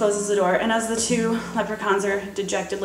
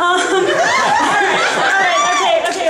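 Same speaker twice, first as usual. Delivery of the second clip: laughing; 7 semitones higher, 5 dB louder, slower than usual. Real, not acted. Laughing at own embarrassment.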